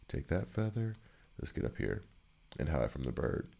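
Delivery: soft hushed sneaking murmur; hushed gameplay. Real, not acted. Hiding from a flying enemy in the game, softly hushed. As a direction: keep it down while hiding.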